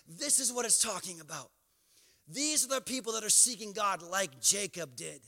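ordinary talk, nothing marked

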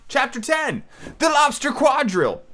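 high-pitched grand title announcement; chapter announcement. A narrator's reading. Reader announcing chapter ten to listeners, grandly.

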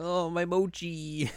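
high, slow mock lament; mock sad wail. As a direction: wail it slowly over the lost snack. Retelling the sad quote.

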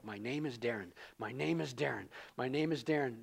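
low, soft chanted repetition; repeating a mantra to convince yourself, softly; mock self-affirmation. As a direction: mutter it over and over like a mantra.

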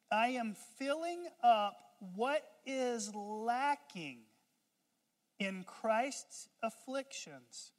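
slow, weighty scripture reading; solemn slow reading of verse twenty-four. Shown as a demonstration. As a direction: read it deliberately to the congregation. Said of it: "look at what he says next".

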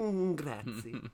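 slow, hammy operatic grazie; drawn-out mock opera singer; opera man voice. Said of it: "like opera man".

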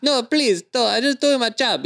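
pleading delivery guy. Retold or imitated. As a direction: play the scared courier begging to be left alone. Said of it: "it's this poor guy".

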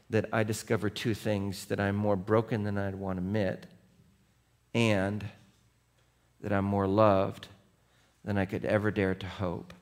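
low, hushed sermon voice; hushed devotional preaching; soft reflective preaching. Acted. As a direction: speak it softly, like a quiet confession. Quiet reflective sermon close.

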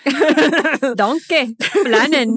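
loud mock Scottish accent; Shrek. Co-host showing host how to sound Scottish, mock Scottish accent. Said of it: "just think of Shrek".

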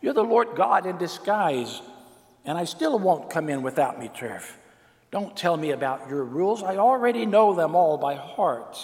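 put-on an old king flaring up in defiance; stubborn king shouting back at God; lashing out. A narrator's reading. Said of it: "the king lashed out".

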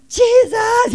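high, loud desperate call; screaming out. Acted. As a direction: scream the name to get his attention. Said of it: "he just screams out".

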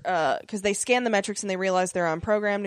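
ordinary delivery

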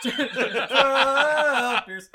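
laughing out the words; bursting into laughter. Real, not acted. Laughing repeat of a funny answer.

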